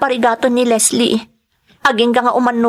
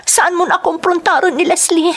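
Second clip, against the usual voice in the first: higher, anxious begging; pleading; 6 semitones higher than usual. Acted. Maid begs Joselito not to confront Leslie, high anxious pleading. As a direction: plead with him to let it go.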